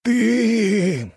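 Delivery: high-pitched, indignant outburst; angry hiss. A narrator's reading. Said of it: "зашипел граф".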